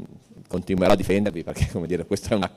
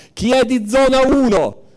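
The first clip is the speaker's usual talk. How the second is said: much higher, louder mock shout, slow; re-enacting a shout at a crowded meeting, high and loud; retold yell; 6 dB louder, slower than usual. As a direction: call out across the room, imitating the yell. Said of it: "allora uno urlò".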